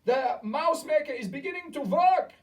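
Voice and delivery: high, loud gloating witch; triumphant shriek. A narrator's reading. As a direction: crow over the spell. Witch triumphant as spell works.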